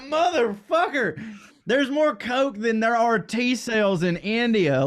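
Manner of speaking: high, loud, stunned disbelief; shocked. Retold or imitated. Retelling his reaction to the news, high stunned disbelief. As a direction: blurt it out half laughing. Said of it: "I was like".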